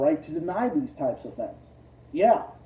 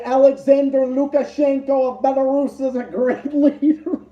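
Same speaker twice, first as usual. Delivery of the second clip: laughing mock slogan; 6 semitones higher, 5 dB louder than usual. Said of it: "And say".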